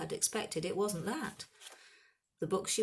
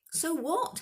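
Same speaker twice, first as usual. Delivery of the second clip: higher, louder outburst; shout; 12 semitones higher, 7 dB louder than usual. A narrator's reading. Harry shouts defiantly at his friends, a louder outburst. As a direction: fling it back at them. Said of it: "Harry shouted".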